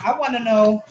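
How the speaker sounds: talking around ice in mouth. Real, not acted. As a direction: ice in mouth, words a little thick. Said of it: "I had some ice in my mouth".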